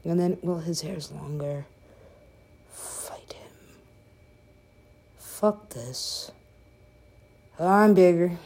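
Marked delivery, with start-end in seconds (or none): 2.7-3.5 whisper. Real, not acted. Urging the lion in game.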